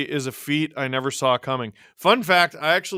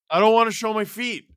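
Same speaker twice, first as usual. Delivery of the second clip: protest; 6 semitones higher, 4 dB louder than usual. Real, not acted. Protests a viewer's request.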